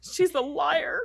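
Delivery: high, slow playful cartoon teasing; exaggerated cartoon intonation. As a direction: sing it out like a cartoon. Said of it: "You sound very exaggerated".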